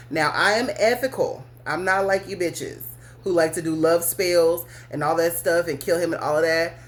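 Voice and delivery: ordinary delivery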